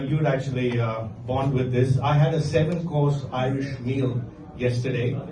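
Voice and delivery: ordinary delivery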